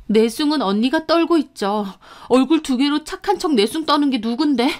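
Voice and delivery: heated, defiant retort; indignant retort. Acted. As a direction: throw the accusation straight back. Victim retorts in quarrel.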